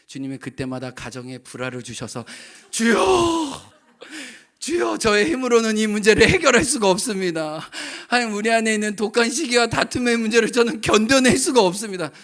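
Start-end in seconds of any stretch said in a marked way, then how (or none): 2.7-12.1 higher, louder fervent prayer; loud preaching-style prayer. Acted. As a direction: cry out to the Lord for help. Praying aloud dramatically.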